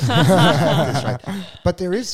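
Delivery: laughs it out, loud and slow; breaking into a loud laugh mid-joke. Real, not acted.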